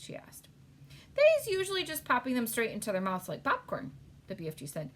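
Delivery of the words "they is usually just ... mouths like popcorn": lightly voiced friendly giant, a bit louder; a hint of a gentle giant, eager; big friendly giant voice. BFG's speech in read-aloud story.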